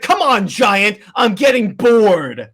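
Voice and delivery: loud taunt, last word drawn out; Wonder Woman. Acted. Warrior fighting Darkseid, loud bored taunt. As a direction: goad a huge foe loudly, slowing at the end. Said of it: "she's like".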